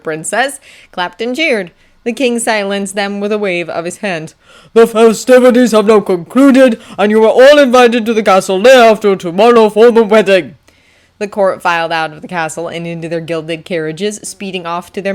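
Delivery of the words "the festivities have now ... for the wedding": loud, raised, grand proclamation; king's public announcement. Acted. King announcing the chosen bride to guests, loud proclamation. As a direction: proclaim it across a great hall.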